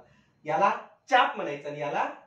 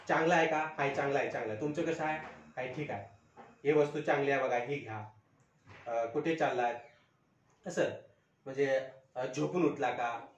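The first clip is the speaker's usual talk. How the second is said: lightly imitated Marwari accent, lower and softer; Marwari-style Marathi; 4 semitones lower, 5 dB quieter than usual. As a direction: mimic outsiders' Marathi. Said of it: "Marwari वगैरे लोक". (मारवाडी ढंगातलं मराठी)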